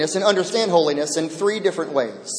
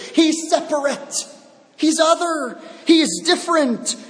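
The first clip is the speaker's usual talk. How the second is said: higher emphatic repeat of separate, other, different; 8 semitones higher than usual. Real, not acted. Preacher to the congregation, emphatic repetition. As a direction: press every He's hard.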